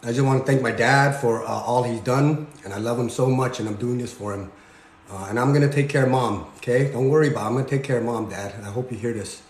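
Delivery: low, subdued voice, holding back grief; choked up. Real, not acted. Son thanking his late dad and promising care for Mom, choked up. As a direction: speak tenderly through real loss, pitch sunk. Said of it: "It gets hard for me".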